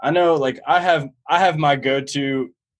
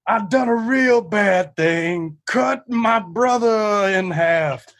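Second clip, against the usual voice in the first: very slow, higher voice unlike his own; imitating movie character; 5 semitones higher, much slower than usual. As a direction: drawl it out like a dim country boy. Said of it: "He goes, uh".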